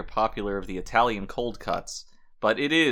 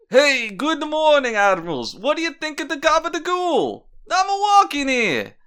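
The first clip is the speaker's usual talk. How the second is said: mock Italian-American; 7 dB louder than usual. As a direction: read it like a hammed-up New Jersey mobster. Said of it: "let's see if I can read this".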